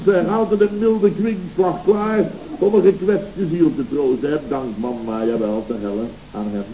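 usual speaking voice

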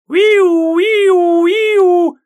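very high, loud siren imitation; siren wail. A narrator's reading. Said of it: "звук сирены". (вой сирены)

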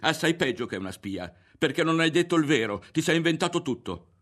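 loud, high-pitched accusation; angry shout. A narrator's reading. Berto accuses Ario of making it all up, angry shouting. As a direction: raise the voice and accuse him. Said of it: "gli gridò Berto".

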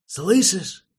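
loud, high harsh bark. Acted. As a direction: bark the order at him. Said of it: "рявкнул кто-то незнакомым голосом".

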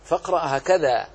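normal talking voice

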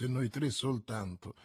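low and hushed, close to a friend. A narrator's reading. Athos warning his comrades, softly hushed. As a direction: murmur so the enemy can't hear. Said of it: "sussurrò Athos".

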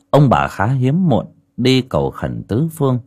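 ordinary delivery, nothing marked

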